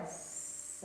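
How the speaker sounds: long breathy sss on the exhale; snake hiss. Shown as a demonstration. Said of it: "exhale like a snake".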